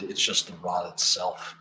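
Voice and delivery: ordinary delivery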